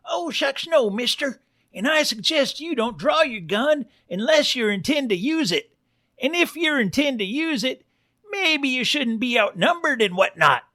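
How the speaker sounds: high-pitched cartoon Western bartender; barkeep pig. Bartender warns an armed stranger off, high cartoon pig voice. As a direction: pitch it way up as a folksy saloon pig.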